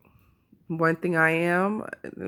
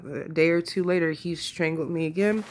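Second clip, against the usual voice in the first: normal talking voice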